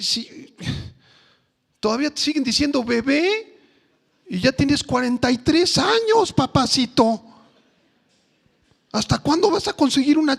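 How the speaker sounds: high, loud exasperated nagging; the Lord scolding. Acted. Preacher voicing the Lord scolding the congregation, high and exasperated. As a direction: scold them like the Lord losing patience. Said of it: "Esto lo está preguntando el Señor".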